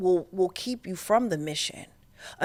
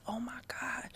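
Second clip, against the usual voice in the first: a hushed oh my God in awe; stunned whisper; 5 semitones higher, 15 dB quieter than usual. Retold or imitated. Recalling the psychic reading, a stunned whisper. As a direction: breathe it out, unvoiced.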